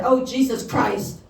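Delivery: exasperated, drawn-out oath; fed-up, stretched-out groan of words; frustrated. Retold or imitated. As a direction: let the retold frustration out slowly. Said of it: "I was getting so frustrated".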